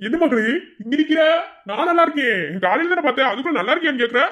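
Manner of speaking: very high, loud comic uncle voice; boisterous uncle. Acted. Uncle greets niece in high loud voice. (உச்சக் குரலில் கலகலப்பான சித்தப்பா)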